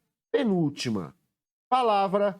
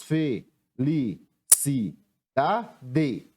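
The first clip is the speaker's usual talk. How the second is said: "fe-li-ci-da-de" very slowly, a pause after each syllable; separando sílabas; 3 semitones lower, much slower than usual. Shown as a demonstration. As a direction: break "fe-li-ci-da-de" into five separate beats. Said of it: "Separando a palavra felicidade". ("fe-li-ci-da-de" pausado, sílaba por sílaba)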